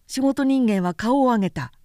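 plain reading voice

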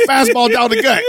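shouted high through laughter; laughing shout. Real, not acted. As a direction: yell it while cracking up. Mocking A-Rod's bad call.

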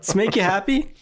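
half-joking high angry jab at the audience; mock yelling. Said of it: "I'm yelling at the audience again".

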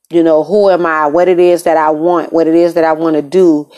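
ordinary talk, nothing marked

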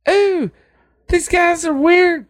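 high-pitched imitation of a sister; sister's voice. Retelling the sister's complaint, high mock voice. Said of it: "the sister said something about".

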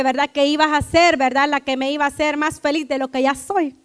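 usual speaking voice

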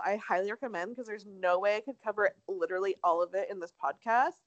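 ordinary talk, nothing marked

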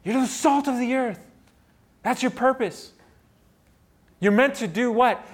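high, loud preaching with "salt" stressed. Acted. Preacher repeating to the congregation, full force. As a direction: repeat it as a calling.

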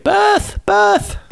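very high-pitched comic squeal; piercing falsetto mock cry; mock sperm scream. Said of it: "starts screaming".

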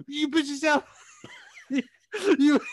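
very high, laughing mock taunt; mock referee taunt. Pretend referee catching a fighter, high mock taunt. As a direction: taunt him as a hammed-up high-voiced ref.

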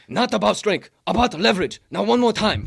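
loud, high barked command; bellowing instructor. Retold or imitated. Heavyweight coaching him through the move, loud and high. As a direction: shout the drill at him. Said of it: "deep, bellowing voice".

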